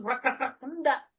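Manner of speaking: normal talking voice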